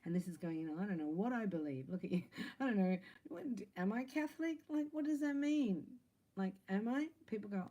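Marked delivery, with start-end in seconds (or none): 2.6-7.1 mock confusion. Mock confusion about religion.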